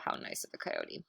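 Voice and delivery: usual speaking voice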